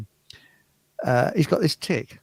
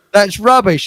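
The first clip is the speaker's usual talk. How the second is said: higher, louder mock heckle; retold heckle; 5 semitones higher, 6 dB louder than usual. A fella heckles the singer, imitated loud dismissal. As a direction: call it out as the unimpressed fella. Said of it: "And the fella says".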